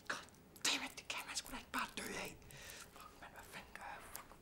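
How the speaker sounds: hushed panicked whispering; frightened whisper. Acted. Man hiding a dead woman, frightened whisper. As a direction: whisper in fear of being heard.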